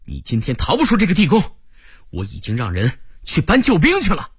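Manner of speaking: high-pitched desperate cry; shouting out. A narrator's reading. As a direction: call out urgently, starting very high. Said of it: "我立刻大叫道". (急切地高声大叫)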